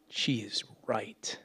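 soft, slow whisper. Acted. Pastor realizing his mother was right, whispered slowly. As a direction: admit it under your breath. Quiet admission of truth.

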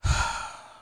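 long unvoiced exhale; winded sigh after a workout; big recovering sigh. Real, not acted. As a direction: let out a relieved breath. Recovering breath after dancing.

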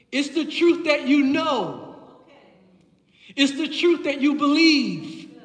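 loud, building pulpit declarations; preaching. Real, not acted. Preacher tells congregation which truth frees, forceful preaching. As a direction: drive each phrase home to the congregation.

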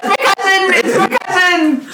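high delighted squeal; excited exclaim. Acted. Character exclaims about cousin.

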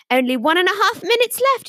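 a hint of a livestream countdown call; imitated online psychic. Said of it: "And she was like".

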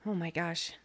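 ordinary delivery

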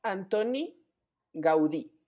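"Antoni Gaudí" with stress on the final í of Gaudí; correct Catalan pronunciation. Shown as a demonstration. Explaining to the co-host how the name is said, gau-DÍ. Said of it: "con acento en la I".

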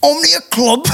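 high, rising thick-accent impression; Ashley Warbridge's thick accent. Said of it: "Ash has a very thick accent".

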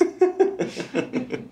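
high, breathless burst of laughter; laughing. Real, not acted. Podcaster getting back on topic after a joke, laughing. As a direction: crack up after an aside.

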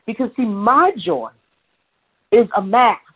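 ordinary delivery

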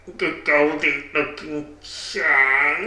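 slow, halting put-on voice; stilted character voice; odd musician character. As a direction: space out the words strangely. Odd musician character repeats band name.

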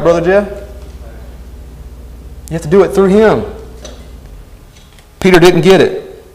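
ordinary talk, nothing marked

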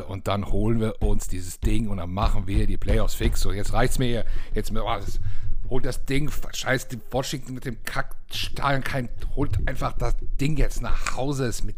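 loud, high ranting cheer; fired-up shout. Real, not acted. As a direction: yell at the team to win. Fired-up rant urging team.

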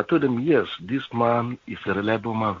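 normal talking voice

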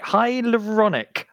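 careful, drawn-out attempt at "Hyaluronic"; struggling to pronounce. Shown as a demonstration. Host practising a hard word, saying "Hyaluronic" slowly and carefully. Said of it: "I can't even say it".

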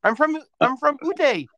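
high-pitched insistence, "Ute" said "oo-TAY"; mock insistence. Imagined stranger tells Tom where they're from, high and insistent. Playing the imagined Utah lover.

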